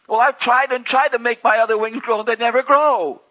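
high, loud, whining complaint; a small angel pouting loudly over his wings; whiny little angel. Acted. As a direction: wail it like a child who can't get his way.

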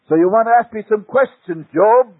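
mighty divine voice, pitched high and loud; terrible voice of God. Retold or imitated. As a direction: thunder down at Job from the whirlwind. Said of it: "out of it came the terrible voice".

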